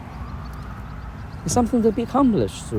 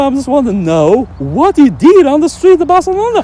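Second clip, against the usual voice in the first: mock eager questioner; 12 semitones higher, 6 dB louder than usual. Mimicking a friendly questioner.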